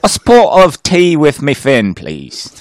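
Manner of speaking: slow, loud mock British; British accent. Co-host as British diver ordering, mock British accent. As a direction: stretch it out like a caricature Brit, dropping at the end. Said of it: "He, he's a Brit".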